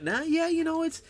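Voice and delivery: lightly imitating a friend sticking up for a game; defending the game. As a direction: play the friend who insists it's realistic. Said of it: "you'd always try to stick up for it".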